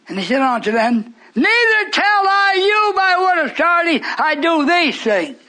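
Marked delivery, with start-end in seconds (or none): 1.3-5.4 high, slow, ringing pronouncement; emphatic scripture declamation. Acted. Preacher giving Jesus' answer to the questioners, declaimed. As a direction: proclaim it in long high tones, dropping on the last word.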